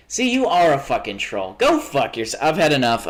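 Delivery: loud, furious rant at a troll; angry outburst. Real, not acted. Streamer losing it at a troll, loud angry. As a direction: blow up at the chat troll.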